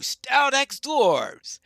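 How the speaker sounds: drawn-out, marvelling exclamation; high, loud and slow, savour the strange name; impressed dwarf booming in wonder; Gimli. Acted.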